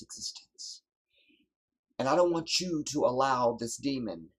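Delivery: high, loud pulpit exhortation; fiery preaching. Real, not acted. Preacher urging his people to stand firm, in loud fiery preaching. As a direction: drive the charge home from the pulpit.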